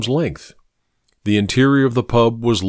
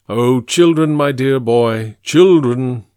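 put-on "children" stressed loud and drawn out; condescending correction, hitting "children"; emphatic correction; 2 semitones higher, 5 dB louder than usual. Mycroft corrects Sherlock grandly.

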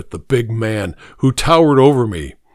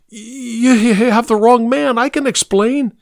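much higher frantic plea; desperate pleading; 11 semitones higher than usual. A narrator's reading. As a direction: plead for your life. Begging the gunman.

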